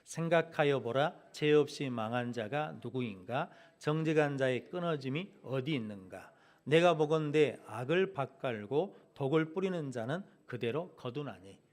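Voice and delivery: slow, loud, measured congregational reading; scripture reading aloud. Real, not acted. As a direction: read the verse out firmly for all to follow, drawing out each phrase. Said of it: "팔 절 말씀 같이 읽어보겠습니다". (성경 구절을 크고 느리게 또박또박 봉독)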